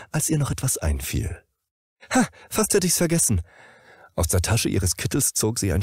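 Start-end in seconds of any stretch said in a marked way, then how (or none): 2.1-3.4 much higher and fast, bright surprise; sudden remembering. Acted. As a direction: catch yourself remembering.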